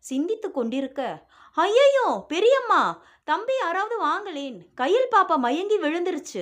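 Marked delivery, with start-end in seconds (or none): 1.6-6.4 much higher, loud alarmed cry for help; panicked scream. A narrator's reading. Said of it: "பேச்சியின் அலறல் சத்தம்". (பதறிய அலறல்)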